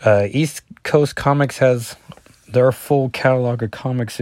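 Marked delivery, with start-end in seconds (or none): none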